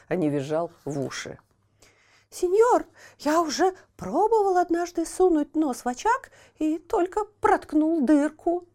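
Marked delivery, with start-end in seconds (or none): 2.3-8.7 put-on much higher, wailing little wooden boy; high lamenting child voice; pitiful puppet boy. A narrator's reading. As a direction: plead in a high thin voice. Buratino voiced in story.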